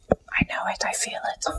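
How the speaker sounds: whispered conviction, very soft; hushed, unvoiced-sounding insistence; intense whisper. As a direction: whisper it like a sure belief. Sister insisting on a hit song.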